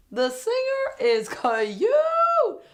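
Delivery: high, loud squeal with drawn-out words; swooning, stretched-out high squeal; excited gushing. Real, not acted. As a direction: gush loudly over a crush. Gushing over attractive singer.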